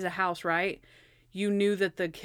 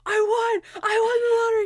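high, loud cry of triumph; excited winner; 14 semitones higher, 7 dB louder than usual. Retold or imitated. Host retells the captain's lottery win, excited shouting. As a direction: shout it out like an imitated jackpot winner.

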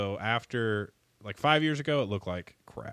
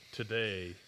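cave voice; much slower than usual. Pretend. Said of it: "Read the, read the beer that we're gonna do today with the cave voice".

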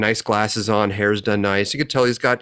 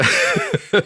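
high laugh. Real, not acted. Friend jokes about him.

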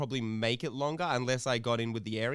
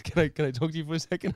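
fast, higher, hammed-up plea; mock prisoner voice; 5 semitones higher, 4 dB louder, faster than usual. Prisoner asking the cleaner for a word, mock pleading voice. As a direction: imitate the eager inmate. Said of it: "He goes".

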